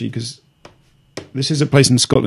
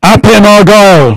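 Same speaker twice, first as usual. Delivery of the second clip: slow, high, full-volume shout; drawn-out yell at the device; extremely loud; 7 semitones higher, 11 dB louder, slower than usual. Real, not acted. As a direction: bellow the place name as a voice command. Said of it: "That's extremely loud".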